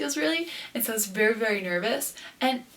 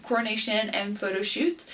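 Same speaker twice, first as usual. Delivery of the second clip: ordinary talk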